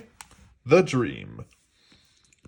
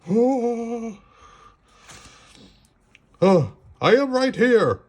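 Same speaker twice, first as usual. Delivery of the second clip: Frog voice; 11 semitones higher, 5 dB louder, slower than usual. A narrator's reading. Frog reassuring.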